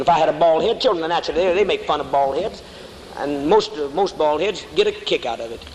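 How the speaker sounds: usual speaking voice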